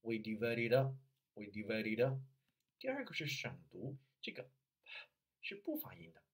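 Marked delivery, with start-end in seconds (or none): 0.0-2.2 "we divide it up" with each final consonant flowing into the next vowel; linked sounds. Shown as a demonstration. Coach showing consonant plus vowel, linked sounds. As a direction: link "divide it up" as one word, p left silent. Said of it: "第一个是连读".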